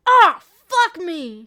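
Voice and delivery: high, very loud, drawn-out yell; sudden loud cry, stretched out; startled shout. Acted. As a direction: yell out in shock. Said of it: "Ellie shouts".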